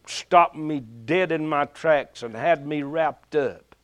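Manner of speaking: normal talking voice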